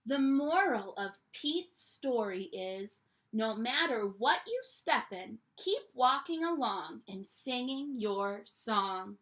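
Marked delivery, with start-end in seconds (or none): none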